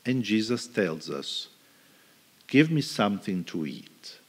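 ordinary talk

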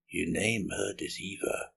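soft, composed seer voice; hushed, calm prophetess; Crethusa. A narrator's reading. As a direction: speak as a quietly ominous seer.

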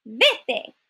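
orden disgustada, con enfado moderado; grito disgustado. A narrator's reading. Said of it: "gritaron disgustadas las hermanastras". (disgusto leído, sin gritar del todo)

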